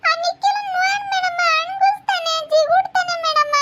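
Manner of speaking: very high, tearful tattling voice; whining child. Acted. Child telling madam that Nikhil is pinching, high whine. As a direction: wail the complaint like a small child.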